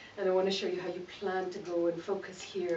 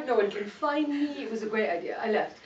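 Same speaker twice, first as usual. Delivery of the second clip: ordinary talk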